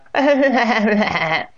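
high, loud acted belly laugh; hearty cackle. A narrator's reading. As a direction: burst out in the aunt's big laugh. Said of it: "burst into her deep-throated laugh".

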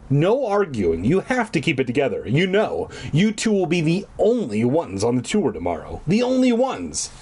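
usual speaking voice

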